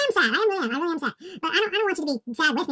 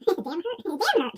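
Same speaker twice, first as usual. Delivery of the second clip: normal talking voice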